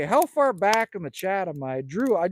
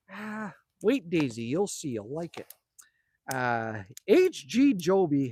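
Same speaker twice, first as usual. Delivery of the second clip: ordinary delivery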